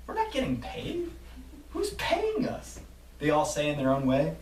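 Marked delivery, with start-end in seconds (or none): 0.1-2.8 confused disbelief. Acted. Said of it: "start laughing with confusion".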